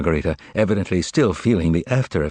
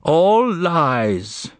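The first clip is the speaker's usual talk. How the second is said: much higher, drawn-out cry of disbelief; exclaimed in chorus; 10 semitones higher than usual. Acted. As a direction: call it out like a whole crowd at once. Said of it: "they all exclaimed in chorus".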